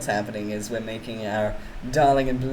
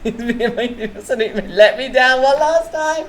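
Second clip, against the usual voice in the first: high, loud, laughing through the words; giggly high protest; 9 semitones higher, 8 dB louder than usual. Real, not acted. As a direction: laugh it out at the chat.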